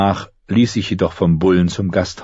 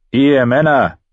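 loud, raised call to a group; loud guard's summons; 7 semitones higher, 6 dB louder than usual. A narrator's reading. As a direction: hail the men from horseback. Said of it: "rief einer der Goldröcke".

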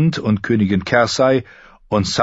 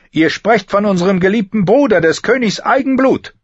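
high, loud sharp warning; queen warning; 14 semitones higher, 6 dB louder than usual. A narrator's reading. Cersei warning Barristan, high and loud. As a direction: warn him off as an angry queen.

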